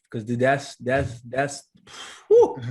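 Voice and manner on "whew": high, loud "whew"; heartfelt whew. Real, not acted. Guest moved by the fathers' series, loud high whew. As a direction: let out a big whew. Overcome while praising their storytelling series.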